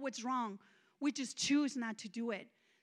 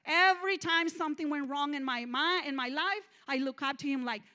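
ordinary talk, nothing marked

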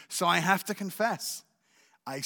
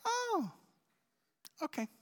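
casual wife; 5 dB quieter than usual. Retold or imitated. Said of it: "she said".

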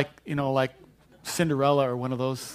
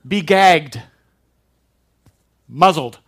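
loud "Be gagged, muzzled"; Jesus commanding the storm, loud and stern; forceful command; 3 semitones higher, 12 dB louder, much slower than usual. Retold or imitated. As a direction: snap the order with full force. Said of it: "He says".

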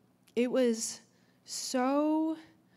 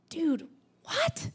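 whispered, higher, in disbelief; stunned whisper; 7 semitones higher than usual. Retold or imitated. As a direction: breathe it in shock. Shocked inner reaction retold.

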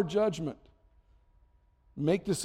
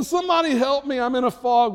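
higher, louder call for help; mock desperate plea; 8 semitones higher, 6 dB louder than usual. Overwhelmed parent calling for help, mock desperate. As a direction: plead like you're lost. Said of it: "You know".

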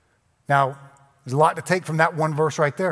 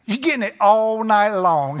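teasing groom; 5 semitones higher, 4 dB louder than usual. Retold or imitated. Husband on wedding night.

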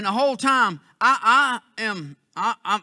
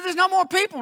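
higher local man; 8 semitones higher than usual. Retold or imitated. Local man voiced in story.

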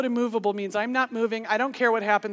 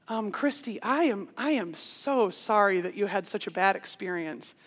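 lightly imitated soft, careful apology; a little nervous; 3 semitones lower, 7 dB quieter than usual. Nervous camp director apologising to an upset camper, soft and hesitant. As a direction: apologise gingerly in a softer voice, as the director. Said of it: "camp director's a little nervous".

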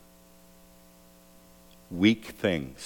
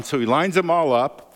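ordinary talk, nothing marked

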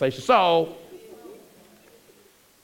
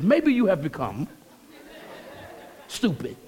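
pastoral version; 2 semitones higher than usual. Retold or imitated. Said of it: "Pastor says".

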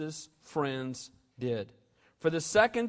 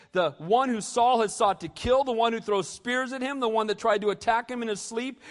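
usual speaking voice

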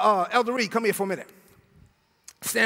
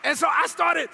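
ordinary delivery

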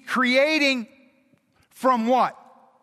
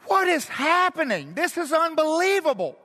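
higher, awestruck; amazed disbelief; 5 semitones higher than usual. Retold or imitated. New parent at a baby's birth, higher amazed disbelief. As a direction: gasp at a birth. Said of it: "and go".

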